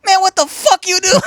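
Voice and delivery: high, loud put-on voice of a startled squatter. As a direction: play the woken-up guy yelling up the stairs. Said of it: "He goes".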